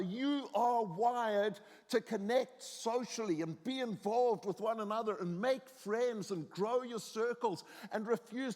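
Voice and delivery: high, driving sermon exhortation; impassioned preaching. Real, not acted. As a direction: preach it with rising conviction, hammering key words. Exhorts the congregation.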